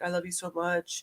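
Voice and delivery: soft, murmured mock declaration of love; mock lovey man. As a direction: coo it like a smitten man. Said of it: "He's like".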